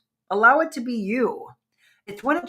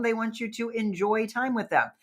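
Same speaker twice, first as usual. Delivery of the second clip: ordinary talk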